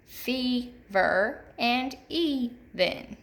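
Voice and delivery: "fever" and "even" slowly split, long E first; stressed long E. Shown as a demonstration. Teacher repeating examples for third graders, "fever" and "even" slowly split with long first E. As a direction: break "fever" and "even" into syllables, stretching the first. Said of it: "hear that long E in the first syllable".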